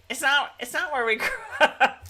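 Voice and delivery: words breaking into giggles; laughing. Real, not acted. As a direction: crack up while protesting. Amused, laughing through the remark.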